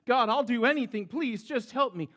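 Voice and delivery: high, loud desperate begging; stranded man begging God to help; frantic plea. A narrator's reading. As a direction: plead for rescue. Fred begging again.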